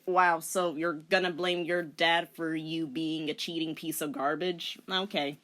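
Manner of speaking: ordinary delivery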